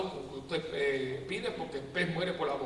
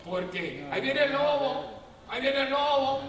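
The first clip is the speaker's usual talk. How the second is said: higher, louder wolf cry, drawn out; 7 semitones higher, 6 dB louder, slower than usual. Retold or imitated. Priest mimicking false alarm, loud and high. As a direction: call out the warning like the boy who cried wolf.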